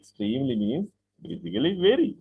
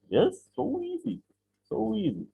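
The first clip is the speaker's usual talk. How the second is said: much higher, lilting "so easy" sung up high; gushing so easy; 9 semitones higher, 4 dB quieter than usual. Acted. Teacher voicing a happy learner answering, high and gushing. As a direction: toss it up like a pupil delighted with English. Teacher plays a delighted student.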